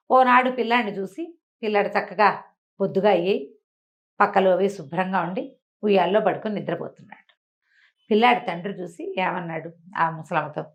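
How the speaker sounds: ordinary delivery, nothing marked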